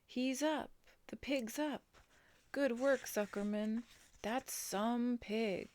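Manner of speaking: high excited calls; cheering crowd. A narrator's reading. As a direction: shout praise from the stands. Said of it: "yelled the crowd".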